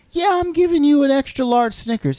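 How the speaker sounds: high, bright, eager voice handing out candy; cheery candy giver. Acted. Homeowner greeting a teen trick-or-treater, high and cheery. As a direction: beam it out like a proud neighbor at the door.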